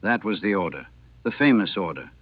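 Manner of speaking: plain reading voice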